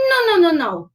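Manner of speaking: high, loud string of noes; protesting doggies. Acted. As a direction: correct a silly friend emphatically. Dogs object to underwear mistake.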